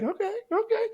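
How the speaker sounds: high, squeaky nervous agreement; nervous buddy. Retold or imitated. Buddy agreeing to the woman, high and nervous. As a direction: go up high, uneasy but willing.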